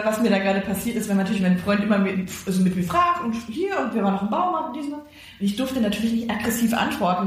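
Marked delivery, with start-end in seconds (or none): none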